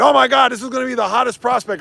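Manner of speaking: mock hype. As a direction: gush over the prospect, hammed-up. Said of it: "how he was saying".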